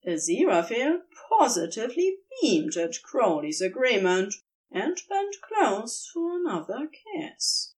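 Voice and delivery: plain reading voice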